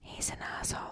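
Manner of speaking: hushed secret insult; giggly prank whisper. Pretend. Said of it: "You can just whisper in the mic".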